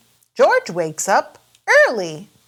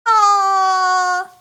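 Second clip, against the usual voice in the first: higher, louder open ah; Peppa says ah; 11 semitones higher, 5 dB louder than usual. Acted. Peppa shows George not to be scared, high open ah. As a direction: show George it's easy. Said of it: "says Peppa".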